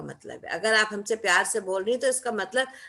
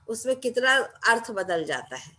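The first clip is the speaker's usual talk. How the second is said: usual speaking voice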